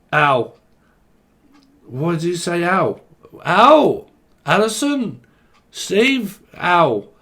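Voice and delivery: high, loud mock yelps and calls; acted joke characters. Englishman yelping as he walks into the bar, hammed-up.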